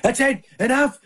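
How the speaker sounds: high, mock-stern father; retold scolding. Dad retelling stepping in with his daughters, mock-stern scolding. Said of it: "my wife can yell at my daughters".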